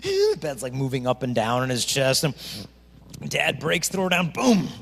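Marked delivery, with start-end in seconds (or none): none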